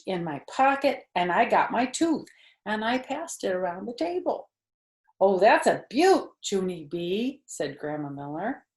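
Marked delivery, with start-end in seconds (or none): 5.2-7.4 grandma's voice. Acted.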